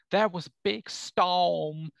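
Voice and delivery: high, loud, slow mock coyness; mock nudge-wink. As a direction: spell out the hint with exaggerated, knowing vagueness. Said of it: "one of those nudge wink".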